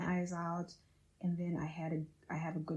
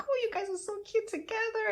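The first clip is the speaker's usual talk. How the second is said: much higher, gushing friend voice; 3 dB louder than usual. Retold or imitated. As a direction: imitate a friend cooing at a couple. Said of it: "and like".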